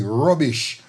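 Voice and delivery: normal talking voice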